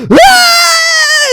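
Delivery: very high, loud cartoon shriek; teacher flees, hammed-up shriek; mock teacher scream.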